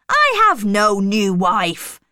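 loud, emphatic protest; shouted denial. A narrator's reading. Rochester denies having a new wife, shouted. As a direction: throw it out forcefully, loudly. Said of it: "he shouted immediately".

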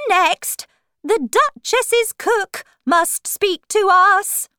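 high, loud court-crier voice; loud announcing. Acted. Rabbit calling the Duchess's cook, loud high announcement. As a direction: call the witness out loudly and high. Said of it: "he said loudly".

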